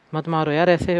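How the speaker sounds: ordinary talk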